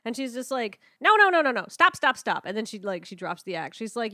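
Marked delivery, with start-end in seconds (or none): 1.0-2.5 higher, louder rapid-fire protest; loud, high-pitched mock outburst; acted exasperated interruption. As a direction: cut in loudly to halt a game. Acted woman halting the role-play.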